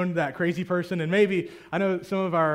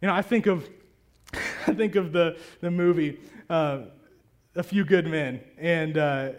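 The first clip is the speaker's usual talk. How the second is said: normal talking voice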